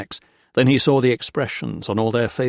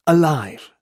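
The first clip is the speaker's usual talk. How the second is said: Alive said higher and louder, falling; emphatic; 5 semitones higher, 5 dB louder than usual. A narrator's reading. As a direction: declare Alive with force. Repeats the word, first loud.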